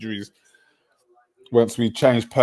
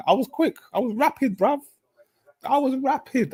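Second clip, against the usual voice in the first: much higher, squeaky brag; excited boasting. Real, not acted. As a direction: crow about how fast you were. Boasting about his pace.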